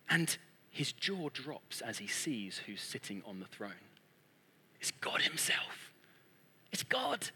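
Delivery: very soft, whispered reveal; hushed, halting wonder; awestruck hush. Retold or imitated. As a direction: whisper it as if stunned by the sight.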